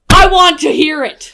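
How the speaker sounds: loud, drawn-out demand; slow, emphatic holler; insistent demand. Real, not acted. Friend demanding the withheld story, loud and insistent.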